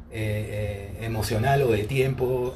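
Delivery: ordinary talk, nothing marked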